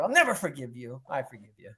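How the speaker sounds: normal talking voice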